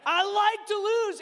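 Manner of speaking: high, slow mimicking of the child; mock-childish repeat; incredulous echo. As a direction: parrot it back in a high, drawn-out voice. Dad echoing son's words in disbelief.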